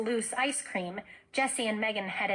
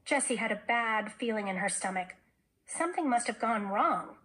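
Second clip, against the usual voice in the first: ordinary delivery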